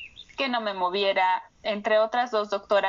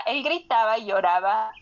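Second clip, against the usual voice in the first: ordinary talk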